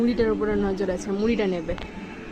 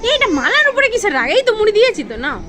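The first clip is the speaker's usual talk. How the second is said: put-on high, louder sing-song baby talk; teasing her pet about its kajal in a high, louder sing-song; 10 semitones higher, 7 dB louder, faster than usual. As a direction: coo at the pet like a little child. Scolding pet playfully.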